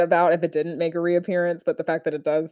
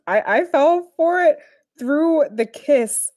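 ordinary delivery

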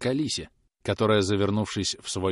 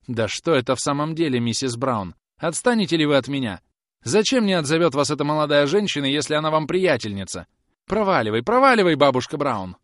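raised voice building to a high shout; exasperated brush-off; 6 semitones higher, 6 dB louder than usual. Acted. Groom tells old woman to leave him alone, exasperated. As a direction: snap at her to get lost.

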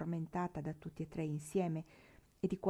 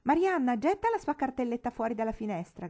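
loud high command, ringing out; boy shouting; 5 semitones higher, 7 dB louder than usual. Acted. Tom shouting to Marianna to throw the case. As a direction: bark the order across the room. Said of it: "gridò il ragazzo".

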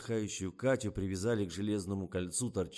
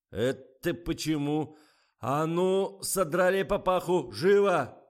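loud, high, demanding bark; indignant shouted order; 10 semitones higher, 11 dB louder, slower than usual. A narrator's reading. Director orders crew to pull off the hat, indignant shouting. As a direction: demand it, building to a sharp shout.

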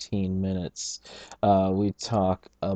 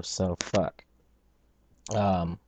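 ordinary talk, nothing marked

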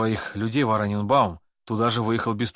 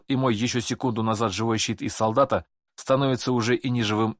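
ordinary delivery

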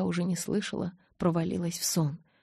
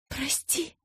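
high, soft, squeezed-out apology; forced out; 8 semitones higher, 4 dB quieter than usual. A narrator's reading. Bella squeezing out an apology to Edward, high and soft. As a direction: barely push the word out. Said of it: "выдавила я".

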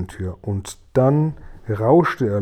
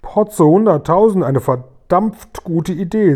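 high, loud exclamation of delight; delighted outburst; 6 semitones higher, 6 dB louder, slower than usual. Acted. Guri thrilled by the ear-seat idea, delighted outburst. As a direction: cry out in delighted surprise, drawing out the words.

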